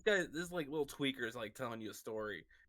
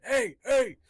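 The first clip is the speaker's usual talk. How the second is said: loud, high mock shout from behind; hammed-up yell for attention; calling out; 10 semitones higher, 11 dB louder than usual. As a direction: holler after the group as the straggler. Acts latecomer calling after the group.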